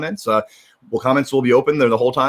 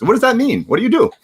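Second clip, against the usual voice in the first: bright upbeat host question, pitched well up; playful high question; 14 semitones higher, 4 dB louder than usual. Real, not acted. Host playfully quizzing her guest, pitched high. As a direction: toss the question over with playful lift.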